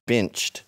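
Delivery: "benched" drawn toward "binched"; imitated country pronunciation. Guest teases host over his accent, Southern vowel on "benched". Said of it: "pronounced benched".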